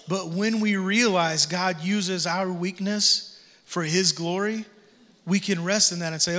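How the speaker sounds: ordinary talk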